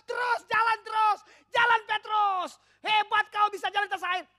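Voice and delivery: loud cheering shout to Petrus; friends cheering. Retold or imitated. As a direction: yell it like friends cheering from a boat. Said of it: "Teriaknya begini".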